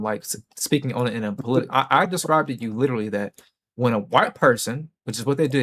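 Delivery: ordinary delivery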